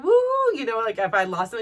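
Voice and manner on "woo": high, drawn-out "woo"; mock cheer, pitched up; imitated cheering woo. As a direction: whoop like an encouraging gym friend. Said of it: "every time she was like".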